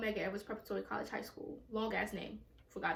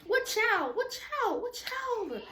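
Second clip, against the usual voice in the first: disbelieving outburst; 10 semitones higher, much slower than usual. Real, not acted. Comic telling her own beating, high indignant outcry. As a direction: cry out in disbelief.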